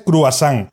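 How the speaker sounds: "croissant" said as cru-a-SÁN; local mispronunciation. Retold or imitated. Speaker listing how locals say it, croissant as cruasán. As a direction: say "croissant" with a U and final NG. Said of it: "aquí hay gente que dice".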